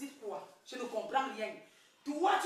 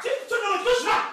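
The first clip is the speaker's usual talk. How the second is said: angry warning; 6 semitones higher, 5 dB louder, faster than usual. Acted. Wife warns him off.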